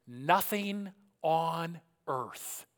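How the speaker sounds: very slow, word by word; slowly. Shown as a demonstration. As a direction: read it out slowly, savouring each word. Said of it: "here I'll read this slowly".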